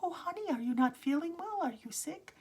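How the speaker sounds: high, tender, caring question; sweet concern. Retold or imitated. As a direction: coo over a child who looks unwell. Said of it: "really sweet, kind person".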